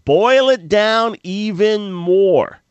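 self-talk pressing himself to simplify; inner thought. Retold or imitated. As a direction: drag it out, telling yourself to simplify. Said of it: "I thought".

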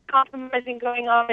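ordinary talk, nothing marked

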